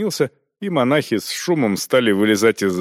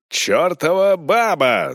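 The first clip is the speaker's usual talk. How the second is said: narrated shout; 8 semitones higher, slower than usual. Acted. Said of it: "заорал Волков".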